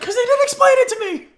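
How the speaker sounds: high, loud whiny excuse; whiny protest. Retold or imitated. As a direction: whine like a scolded kid. Retold whiny self-defense.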